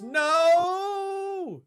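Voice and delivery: high, loud yelp of no; sudden panicked no; shocked no. Real, not acted. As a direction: cry out in alarm. Streamer reacts to redemption.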